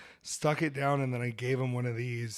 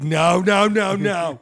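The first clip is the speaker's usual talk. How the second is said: mock protest; 5 semitones higher, 9 dB louder, much slower than usual. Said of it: "She'd be like".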